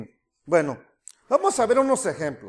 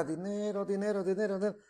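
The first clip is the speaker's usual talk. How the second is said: much softer, murmured repetition; scanning for a word; 2 semitones lower, 11 dB quieter than usual. Acted. Son searching his father's letter for money, quiet scanning. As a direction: murmur it as if skimming a page. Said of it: "buscas la palabra dinero en toda la carta".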